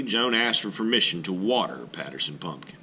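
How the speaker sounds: ordinary delivery, nothing marked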